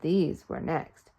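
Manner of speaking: plain reading voice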